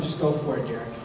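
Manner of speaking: low, soft go-ahead; retold quiet prompt. Interviewer letting the new hire answer, quiet prompt. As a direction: drop down low and soft to give permission.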